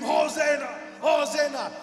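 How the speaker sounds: loud crowd cheer, acted out; excited scream. Preacher acting the crowd welcoming Jesus, excited shouts. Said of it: "All the people are excited and scream".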